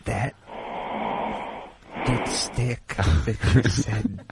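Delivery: slow, hushed relaxation-tape whisper; mock relaxation-tape whisper. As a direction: breathe it like a meditation tape. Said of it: "We should put that on a cassette".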